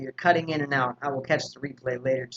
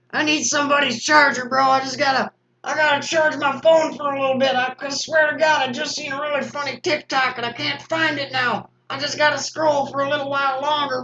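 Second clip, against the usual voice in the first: higher, louder desperate begging for a charger; pleading phone addict; 9 semitones higher, 4 dB louder than usual. Pretend. Himself as a hooked scroller begging strangers, desperate whine.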